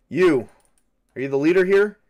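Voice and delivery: demanding challenge. Acted. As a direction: challenge the stranger in charge.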